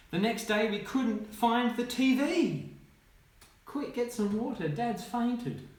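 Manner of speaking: high, urgent read-aloud; dramatic storytelling. A narrator's reading. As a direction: build to a high-pitched storybook climax.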